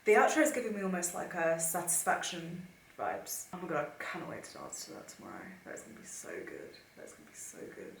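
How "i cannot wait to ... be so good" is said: softer, hushed whisper of delight; whispered excitement. Real, not acted. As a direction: whisper it so no one wakes. Quiet excitement late at night.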